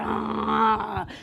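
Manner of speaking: drawn-out imitated growl; bear-like growl. Showing how she laboured, bear-like growl. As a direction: growl through an imagined contraction. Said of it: "growling like a bear".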